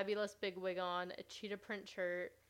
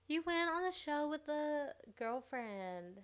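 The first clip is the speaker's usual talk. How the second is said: higher, slow mock Southern accent; Raven impression; 7 semitones higher, slower than usual. Podcaster mocking Raven to her cohost, bad Southern accent. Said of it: "That's a horrible accent".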